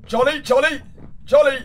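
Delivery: normal talking voice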